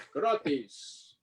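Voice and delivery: usual speaking voice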